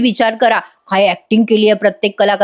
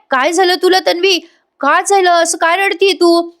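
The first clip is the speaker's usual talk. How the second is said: high-pitched, worried questioning; acted alarm; 7 semitones higher than usual. Ashwin asks crying Tanvi what happened, acted alarm. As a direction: imitate a startled, concerned questioner.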